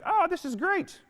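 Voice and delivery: high, chirpy mock cheer; fake happiness. Mocking fake positivity for the audience, high chirpy cheer. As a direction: chirp it like forced toxic positivity. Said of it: "So I'm not encouraging you to be like".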